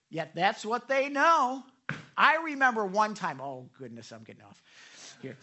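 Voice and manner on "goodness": ordinary delivery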